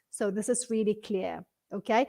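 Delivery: normal talking voice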